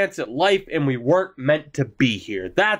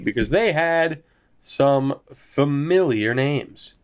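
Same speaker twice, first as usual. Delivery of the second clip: ordinary talk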